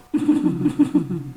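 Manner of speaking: small suppressed giggle. Acted. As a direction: let a giggle slip out.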